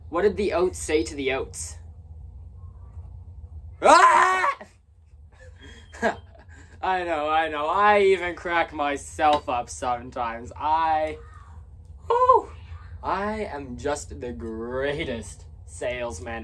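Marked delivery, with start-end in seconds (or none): 3.8-4.5 very high, loud shriek; punchline shriek. Acted. Punchline of own joke. 12.1-12.5 mock cry. Overcome by own joke.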